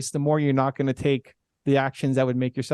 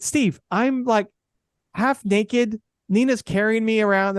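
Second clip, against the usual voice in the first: high, incredulous objection; exasperated protest; 7 semitones higher, slower than usual. Retold or imitated. Refusing his agent's filming idea, high exasperated protest. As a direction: push back high, almost pleading.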